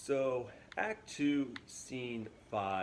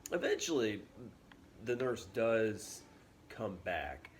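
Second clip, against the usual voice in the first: ordinary delivery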